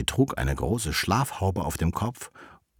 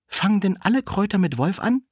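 put-on high, questioning little-girl voice; Rotkäppchen asking; 13 semitones higher, 3 dB louder than usual. Rotkäppchen asks the wolf about herbs, high-pitched and questioning. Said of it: "fragte es".

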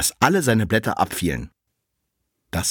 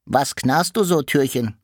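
much higher, louder toy-like cart voice; the little cart asks the door, high and louder; 5 semitones higher, 3 dB louder than usual. Acted. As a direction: ask it brightly like a little wagon. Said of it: "kam ein Wägelchen vorbei und sprach".

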